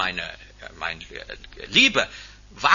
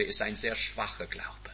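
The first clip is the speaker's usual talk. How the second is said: hushed emphasis; 5 semitones lower, 9 dB quieter than usual. Real, not acted. Preacher drops to point.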